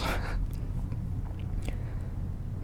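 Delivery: a quick real chuckle at the slip; soft breathy laugh. Real, not acted. Reader laughing at a misread word, soft real chuckle. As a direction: laugh it off lightly.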